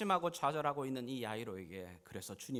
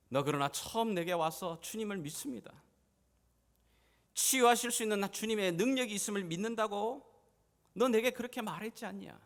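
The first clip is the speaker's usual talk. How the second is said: 점점 힘주어 따져 묻듯; 다그치는 주님; 8 semitones higher, 8 dB louder than usual. Acted. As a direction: remind him forcefully of his own confession. 예수가 야이로를 다그치는 장면. (힘주어 다그치는 목소리)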